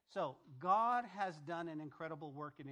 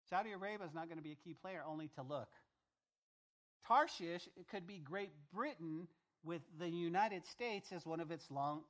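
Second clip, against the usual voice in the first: normal talking voice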